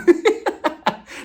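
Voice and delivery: bursts out laughing high; loud laugh. Real, not acted. Vlogger laughing at her own joke, loud laugh. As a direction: cackle at the joke.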